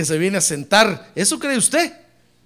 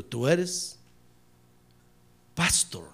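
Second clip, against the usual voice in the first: lower, soft, grave pronouncement; a hint of the Spirit's grave verdict; solemn voice of God; 3 semitones lower, 5 dB quieter, much slower than usual. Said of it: "el Espíritu me dijo".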